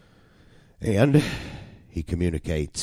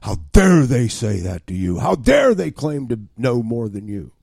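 agitator's voice, building to loud indignation; Satan; 3 semitones higher, 6 dB louder than usual. Acted. Agitator in your ear stirring a quarrel, rising indignant outrage. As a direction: egg them on, outraged on their behalf. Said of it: "the whisperer and the agitator is Satan".

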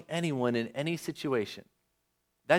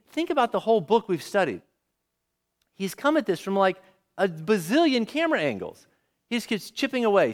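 ordinary talk

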